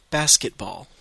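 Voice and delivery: basketball said the English way, BAS-ket-ball; basketball, English vowels, clear teacher model; English pronunciation. Shown as a demonstration. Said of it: "deux fois en anglais".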